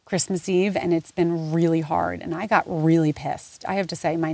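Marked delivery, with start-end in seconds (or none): none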